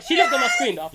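indignant shout at a cheating friend; shouted accusation. Real, not acted. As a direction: call her out, half laughing. Accusing player of cheating, laughing.

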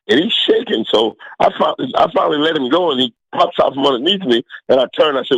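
ordinary talk, nothing marked

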